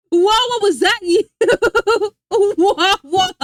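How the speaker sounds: calling the name out through real laughter; laughing. Real, not acted. Amused streamer laughing as she calls out the name.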